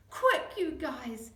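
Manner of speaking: slow, out-of-breath gasp; breathless urgent plea. Acted. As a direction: gasp it while straining. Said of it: "he gasped".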